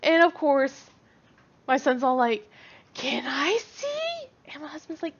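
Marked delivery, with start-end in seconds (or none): 2.9-4.3 put-on high, slow little-boy plea; eager kid voice. Son begging dad to see the picture, high and slow. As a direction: beg like an excited kid.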